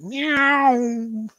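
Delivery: high playful meow; cat meow. Pretend.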